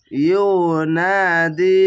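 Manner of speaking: ইউনাদি recited slowly with the alif held one count; one alif stretch. Shown as a demonstration. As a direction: draw out ইউনাদি, holding the long a one beat. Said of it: "এখানেও এক আলিফ টেনে পড়বেন".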